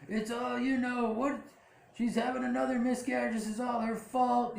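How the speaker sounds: father blaming her, mock high yell; mock scream. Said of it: "he's screaming".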